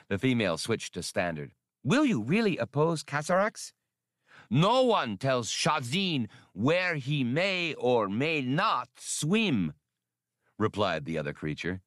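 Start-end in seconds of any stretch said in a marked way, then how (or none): 1.8-3.7 high, alien voice of the female; female swimmer creature. A narrator's reading. 4.5-9.7 slow, high defiant creature voice; defiant swimmer bull. A narrator's reading. Shazin answers defiantly.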